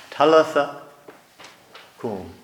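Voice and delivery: gentle Aramaic "Talitha cum"; Aramaic words. Shown as a demonstration. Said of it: "give it in the original language".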